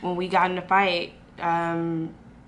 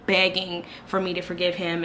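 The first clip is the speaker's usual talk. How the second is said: ordinary talk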